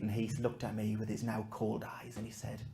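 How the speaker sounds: ordinary talk, nothing marked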